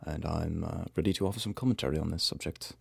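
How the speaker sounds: hushed, self-important expert introduction; mock-pretentious scholarly voice. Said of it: "we sound quite pretentious".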